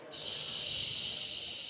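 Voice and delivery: drawn-out hushing noise; long shush. Real, not acted. Quieting the audience before a welcome, long shush. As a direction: shush a whole room.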